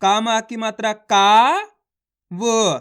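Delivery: ordinary delivery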